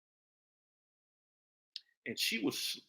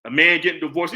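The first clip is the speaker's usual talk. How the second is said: normal talking voice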